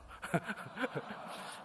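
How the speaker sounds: high breathy chuckle; soft amused giggle; light laugh. Real, not acted. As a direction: laugh at how happy he was. Laughs after hanging up.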